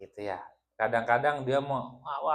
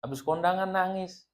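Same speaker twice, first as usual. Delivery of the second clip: complaining wife; 6 semitones higher, 3 dB quieter than usual. Acted. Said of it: "habis ngaji nangis".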